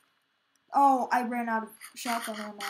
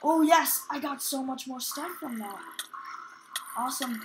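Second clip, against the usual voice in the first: ordinary talk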